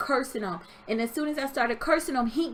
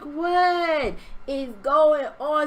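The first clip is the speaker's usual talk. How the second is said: retold bewilderment; 3 semitones higher, 4 dB louder, much slower than usual. Retold bewilderment mid-attack.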